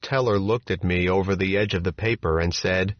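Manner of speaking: plain reading voice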